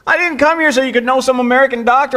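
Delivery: the doctor as Paul, protesting the praise. Retold or imitated. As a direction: insist hard, correcting the crowd. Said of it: "And Paul goes".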